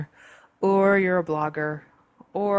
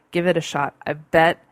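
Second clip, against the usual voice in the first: usual speaking voice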